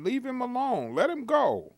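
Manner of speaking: high, loud retold shout. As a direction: call out to stop them. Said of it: "you could hear him clearly saying that".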